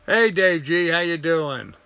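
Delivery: normal talking voice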